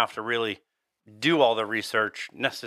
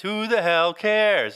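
slow, higher cartoon quote voice; quoting Family Guy; 8 semitones higher, 4 dB louder, slower than usual. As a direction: drag out the Family Guy line. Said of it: "a Family Guy reference".

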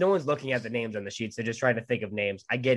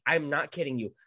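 normal talking voice

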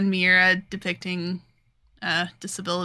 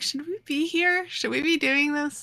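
nervous Rickard; 7 semitones higher than usual. Retold or imitated. Nervous Rickard voiced in recap.

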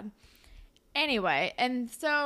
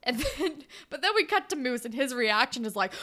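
ordinary talk

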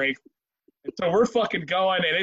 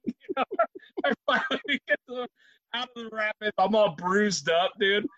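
ordinary talk

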